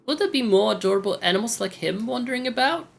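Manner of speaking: put-on very high little-girl voice; high put-on girlish voice; sweet daughter character, curious.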